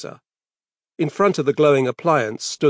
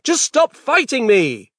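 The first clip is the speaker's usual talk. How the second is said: loud, slow, high plea; strained shout; 10 semitones higher, 5 dB louder, slower than usual. Acted. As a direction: shout through the struggle. Struggling with son.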